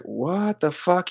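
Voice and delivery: high, slow, stunned reaction; retold disbelief. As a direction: act out quiet, drawn-out disbelief. Retold shock at coach leaving.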